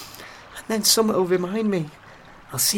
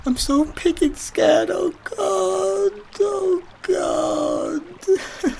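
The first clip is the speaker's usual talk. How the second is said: scared; 9 semitones higher, much slower than usual. Acted. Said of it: "I'm so scared".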